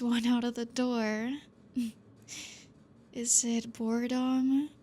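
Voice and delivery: usual speaking voice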